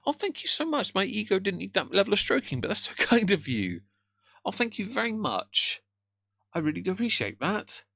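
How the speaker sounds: high-pitched, flustered thanks; awkward gratitude. Real, not acted. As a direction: gush thanks in a high, embarrassed voice. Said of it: "Awkwardness intensifies".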